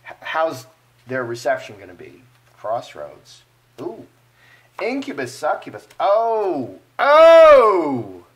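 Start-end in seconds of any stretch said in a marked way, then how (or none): 6.0-8.2 startled cry building to a big high howl; loud, rising "oh". Real, not acted. Card reader reacting to the cards, loud drawn-out "oh!". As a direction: exclaim "oh", then much louder and longer.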